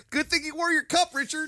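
high, loud mocking heckle; imitated spectator's taunt, high and loud; heckler from stands. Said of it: "El Prez from the stands going".

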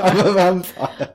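mock grand vampire. As Neville Chamberlain joking, slow hammed vampire voice. Historical figure announces himself.

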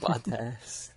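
soft, careful Bottas as "baht-ass"; attempted British pronunciation. Shown as a demonstration. American trying what's hard to say, soft baht-ass. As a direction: try Bottas quietly with an open first vowel. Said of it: "it's hard to say".

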